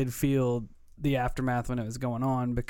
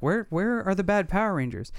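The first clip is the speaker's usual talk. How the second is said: higher-pitched retold question to a child; lightly voiced questioning dad; 7 semitones higher than usual. Dad asking his son about Power Rangers, lightly voiced parent. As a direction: imitate a parent gently quizzing a little kid, pitched up.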